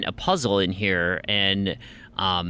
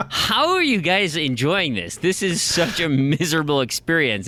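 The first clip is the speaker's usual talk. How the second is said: higher-pitched, exasperated appeal; retold parental dismay, pitch raised; baffled disbelief; 6 semitones higher than usual. Retells bafflement at kids enjoying game.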